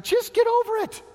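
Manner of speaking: a high, flippant get over it; high dismissive quote. Retold or imitated. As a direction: squeak it out, mock-breezy. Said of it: "And he just says".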